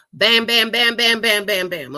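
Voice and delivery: loud punchy bam noises; bam bam sound effect. Pretend. Wife jokingly listing what she gives her husband, loud bams. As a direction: punch out each bam like a drumbeat.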